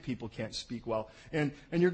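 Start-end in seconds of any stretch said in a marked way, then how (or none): none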